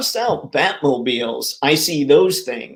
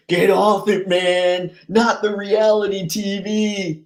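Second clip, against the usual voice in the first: higher-pitched, hammed-up dismay; mock protest; 5 semitones higher than usual. Streamer teasing over reality TV, mock dismay. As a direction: wail it in playful protest.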